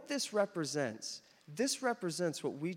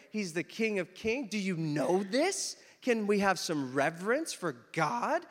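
indignant retold tirade, pushed louder; retold going off; 3 semitones higher, 5 dB louder than usual. Telling off a woman who called God Daddy, indignant. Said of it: "I just went off on her".

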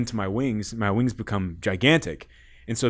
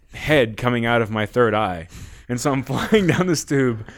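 ordinary delivery

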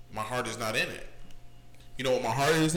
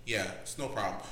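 calm front; 6 semitones lower, 7 dB quieter than usual. Retold or imitated. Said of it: "my demeanor is like".

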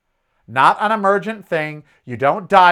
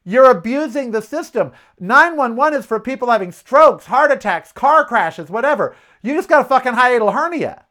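raised, exasperated telling-off; indignant scolding; 6 semitones higher, 4 dB louder than usual. Retold or imitated. Neighbor scolding the woman who keeps calling 911, indignant raised voice. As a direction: scold her for abusing the system. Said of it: "And I said to her".

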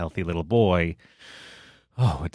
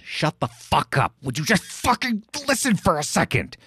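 mock exasperated parent, much higher on the second line; 8 semitones higher than usual. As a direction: act out the frustration you'd never say aloud. Parent's unspoken outburst at child.

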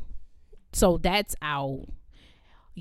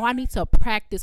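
mock diva; 3 semitones higher, 8 dB louder than usual. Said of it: "Talking about".

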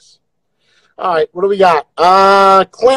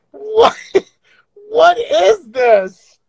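panicked crying out; 12 semitones higher than usual. Real, not acted. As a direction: wail in disbelief. Crying out at filters.

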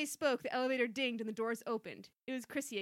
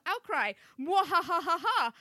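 evil laugh; 5 semitones higher, 9 dB louder, slower than usual. Acted. Villain laugh in overheard fragments.

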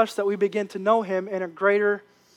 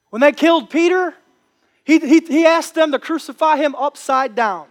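louder, higher preaching build; fired-up preaching; 5 semitones higher, 5 dB louder than usual. Acted. Preacher rousing the congregation, louder and higher. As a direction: preach it with rising fire.

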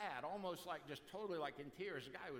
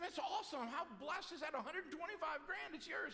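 excited friend; 11 semitones higher than usual. Acted. Friend cheering at the news of the inheritance, excited and much higher. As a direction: cheer him on as if he hit the jackpot.

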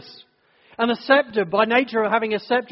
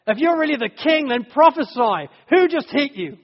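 higher, louder taunting jeer; mocking taunt; 6 semitones higher, 4 dB louder than usual. Soldiers taunting the struck prisoner to prophesy, jeering. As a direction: goad him like a cruel soldier. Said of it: "they're mocking him throughout".

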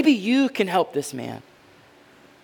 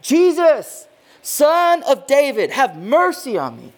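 high, loud desperate plea, Son of David slowed; crying out; 8 semitones higher, 5 dB louder, slower than usual. Retold or imitated. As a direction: call out over the crowd, begging. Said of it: "he cries out".

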